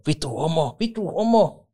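high-pitched jeering shout; mock yell. Two men heckling the referee, mock yell. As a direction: heckle like two worked-up fans. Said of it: "molemmat huutaa".

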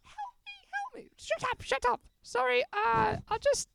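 flustered falsetto character, stopping and starting; very high comic put-on voice; Pope Benedict. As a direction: squeak out excuses between mock pleas for help. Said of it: "Pope Benedict, mate".

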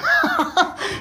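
a high, delighted laugh; imitated laugh. Preacher acting Peter on the water, delighted laugh. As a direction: laugh with wonder, walking on water. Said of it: "he probably started laughing".

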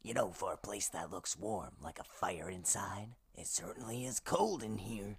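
low whisper in the cold dark; hushed whisper. Acted. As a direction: keep it hushed behind the pillar. Hiding behind pillar.

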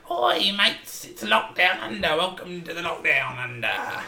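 high-pitched mock-Australian gone Cockney; drawn-out, put-on Cockney greeting; Cockney character. Podcast host opening the show as a Cockney character. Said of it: "why is the introduction a Cockney character".

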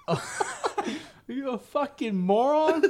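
hammed-up chewing out with laughter; Dear God Actual. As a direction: drag out the insult, high and loud. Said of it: "Dear God Actual fucked up that private".

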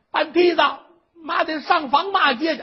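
high, loud order; stage-style indignant command; belligerent old mother. Acted. Mother ordering her son to fetch a ladder, belligerent.

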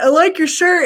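high fake-sweet salesperson voice; salesperson gushing. Mall salesperson flattering her shirt, high fake-sweet voice. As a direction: put on a high sugary sales pitch. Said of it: "And they're like".

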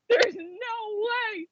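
high, slow, half-crying mock wail; mock disbelieving wail. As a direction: whine it out slowly in mock despair. Retold disbelief when he called.

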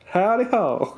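cowboy greeting. Pretend. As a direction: play a hokey cowboy saying hi.

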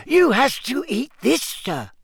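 high squeaky elf voice; squeaky house-elf. A narrator's reading. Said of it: "squeaked the elf".

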